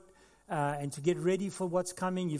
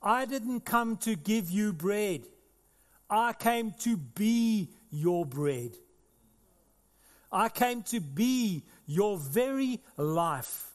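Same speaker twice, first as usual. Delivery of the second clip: slow, louder, deliberate proclamation; solemn declaration; 3 semitones higher, 3 dB louder, slower than usual. Acted. Preacher voicing Jesus to the crowd, slow and solemn. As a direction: drag each word out with weight. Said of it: "Jesus is saying to them".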